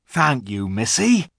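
lightly voiced giant, lifting at the end; a touch of country giant, rising on the last word; grateful rustic giant. A narrator's reading. Giant thanks Lucy for her handkerchief, lightly grateful. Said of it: "said Giant Rumblebuffin".

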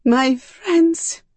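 high-pitched, grieving cry; anguished, pitched well up; tearful. A narrator's reading. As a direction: say it with tears welling. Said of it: "I whispered, tears welling in my eyes".

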